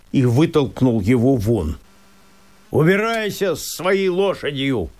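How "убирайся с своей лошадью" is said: высокий, но не громкий окрик; гони его прочь голосом; крик вслед. Acted. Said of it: "закричал он ему вслед". (лесник кричит вслед мужику)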